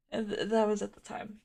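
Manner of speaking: usual speaking voice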